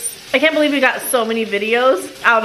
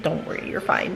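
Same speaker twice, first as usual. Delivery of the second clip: murmured reassurance; 7 semitones lower, 8 dB quieter than usual. Retold or imitated. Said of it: "would have said".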